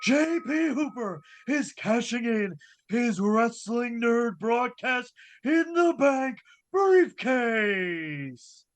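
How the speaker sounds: slow, drawn-out ring announcer call; boxing ring announcer. Acted. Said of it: "Let's send it to our ring announcer, Mister [name]".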